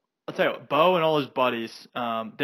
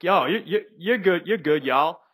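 put-on southern accent; 6 semitones higher than usual. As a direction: imitate a friendly southern guy's accent. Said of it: "in his southern accent".

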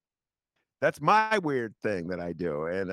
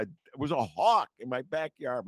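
usual speaking voice

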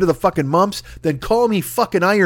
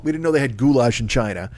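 normal talking voice

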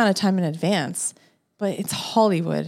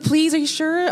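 pleading, high-pitched; begging; 9 semitones higher than usual. Retold or imitated. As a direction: beg the guard to let you park. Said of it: "I begged them".

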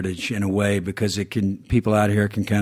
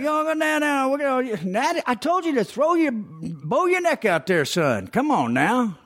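Southern coach impression; 13 semitones higher than usual. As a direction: coach barking instructions in a high Southern twang.

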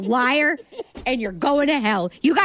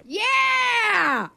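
triumphant cry; 7 semitones higher than usual. Real, not acted. Guessed the song, triumphant.

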